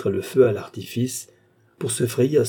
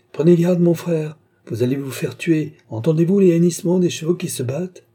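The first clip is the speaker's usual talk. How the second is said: high, loud, urgent plea; raised voice, anxious for him; alarmed warning; 6 semitones higher, 5 dB louder than usual. A narrator's reading. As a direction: warn your brother off in alarm. Sister warns brother of deadly danger.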